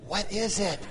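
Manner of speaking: put-on high, soft eager toddler; eager little girl. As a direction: ask breathless like a curious three-year-old. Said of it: "got right at my face".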